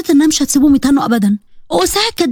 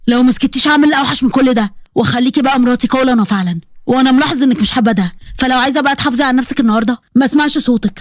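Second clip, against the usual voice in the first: lowered man's voice, controlled menace; threatening husband; 2 semitones lower than usual. Acted. Husband threatening his bride in the car, stern. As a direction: lay down a stern warning, pitched a little low.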